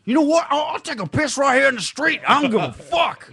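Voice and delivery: very high, loud hammed-up [name] voice; wild mock [name] bravado; [name] impression. [name] announcing he'll piss in the street.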